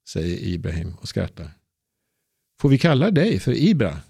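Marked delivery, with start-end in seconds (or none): 2.6-4.0 put-on high, eager child's question; a girl asking a favour of the grown-up; hopeful little girl. A narrator's reading. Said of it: "frågar Ebba". (förhoppningsfull liten flicka, ljus röst)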